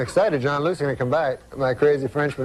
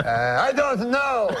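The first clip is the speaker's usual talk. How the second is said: slow, high French-Canadian bafflement; Jean-Luc's French-Canadian accent; 7 semitones higher, slower than usual. Acted. Uncle gives up on cabbie's riddle, high French-Canadian accent. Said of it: "That's how he talks".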